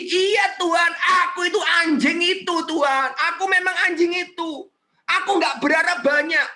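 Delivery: high, impassioned begging; self-humbling plea. Acted. Woman begging the Lord for mere crumbs, humble pleading. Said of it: "lalu dia merendahkan diri".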